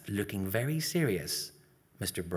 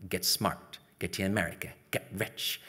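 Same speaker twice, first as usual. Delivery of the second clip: normal talking voice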